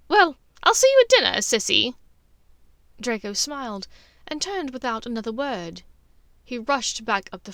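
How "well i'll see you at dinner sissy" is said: high, loud, quick sing-song goodbye; brisk Bellatrix. Acted. Bellatrix dismisses her sister, quick high loud farewell. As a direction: toss it off fast and bright.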